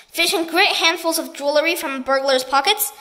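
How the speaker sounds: ordinary delivery, nothing marked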